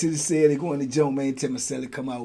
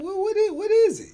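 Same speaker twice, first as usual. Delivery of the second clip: much higher, puzzled asking; startled question. Retold or imitated. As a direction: ask it high and baffled. Confused about the device.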